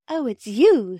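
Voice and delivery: put-on a girl suddenly recognizing the old man; surprised recognition. A narrator's reading. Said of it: "said Bobby".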